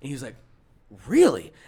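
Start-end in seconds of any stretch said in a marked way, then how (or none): 1.1-1.5 weird incredulous tone. Retold or imitated. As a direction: mimic a customer's put-out surprise. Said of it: "he gets that weird tone".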